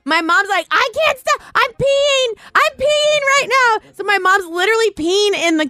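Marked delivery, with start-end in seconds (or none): 0.7-3.8 high, helpless retold squeal; imitated laughing-hard squeal. Mom phoning from the car, imitated helpless high squeal. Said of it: "they're laughing so hard about it".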